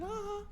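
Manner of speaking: very high cartoon puppet "ah"; badly faked falsetto Elmo noise; high Elmo giggle. Introducing Elmo Peterson, high puppet giggle. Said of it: "to Elmo Peterson".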